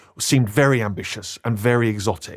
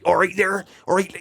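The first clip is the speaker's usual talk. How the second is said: higher-pitched mock Bristol accent; mocking West Country accent; 6 semitones higher than usual. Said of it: "people started mocking the accent".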